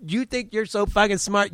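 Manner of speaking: put-on a girl taunting the reporter, high and sneering; mocking girl. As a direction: sneer it like a sassy kid. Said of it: "She's like".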